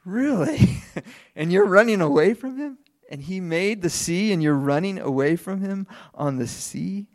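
high, loud mock disbelief; incredulous sailors. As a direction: scoff at him in amazement. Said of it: "they look at him and say".

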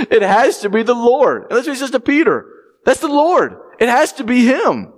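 high, rising exclamation; excited realization. Acted. Preacher voicing John telling Peter, excited. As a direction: proclaim it with growing awe. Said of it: "rising to the climax".

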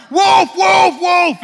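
high, loud shout of wolf; yelling wolf. A narrator's reading. Shepherd boy crying wolf across the valley. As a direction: shout the alarm at full force. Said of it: "he starts yelling".

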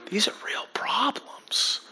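whispered, slow, mock-grave. Pastor mocking rich people problems to the congregation, in a whisper. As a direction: whisper it like a dire secret.

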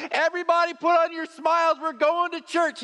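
mock a parent forcing smiles before church; fake-cheery parent. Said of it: "mom or dad turns around and goes".

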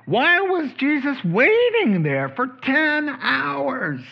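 high, slow drawn-out preaching. Real, not acted. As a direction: stretch each phrase out.

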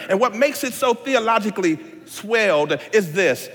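ordinary delivery

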